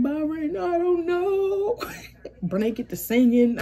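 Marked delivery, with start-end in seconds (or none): 0.0-1.8 high whine with the last word drawn out; mock crying. Crying sister answering her fussing brother, mock wail. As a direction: wail it helplessly, imitating yourself crying. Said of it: "I'm crying".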